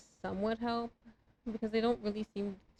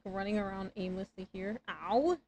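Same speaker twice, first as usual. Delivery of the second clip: usual speaking voice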